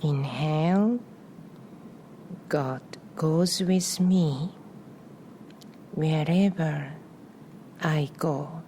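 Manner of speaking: calm meditation voice with long pauses between phrases; guided breathing meditation. Real, not acted. Guiding listeners to inhale and exhale on a prayer, meditative. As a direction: lead the breath gently, phrase by phrase, leaving room after each.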